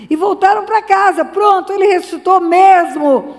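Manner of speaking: loud, high preaching burst; rising preaching. Real, not acted. Preacher crying out to the congregation, loud and high. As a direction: lift the church with it.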